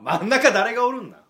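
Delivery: loud, high comic challenge; tsukkomi retort. Acted. Comedian answering a greeting that skips someone, loud tsukkomi. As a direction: fire back with mock indignation.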